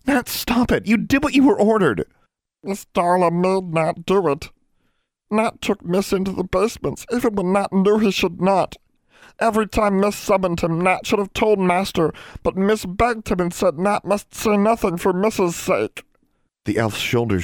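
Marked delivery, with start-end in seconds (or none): 0.0-2.0 high, loud, urgent order; raised voice, trying to stop him; urgent command. A narrator's reading. Grabs the self-punishing elf, orders him. 2.6-16.0 high-pitched, pleading elf voice; high, anguished little elf; distraught house elf. A narrator's reading. Elf's distraught confession.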